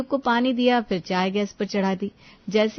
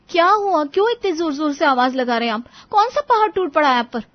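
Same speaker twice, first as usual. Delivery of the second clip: higher-pitched, exasperated questions; angry; 7 semitones higher, 7 dB louder than usual. Acted. Wife answers husband's loud calls, angry and exasperated. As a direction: snap back in annoyance. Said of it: "इतने गुस्से में क्यों बोल रही हो".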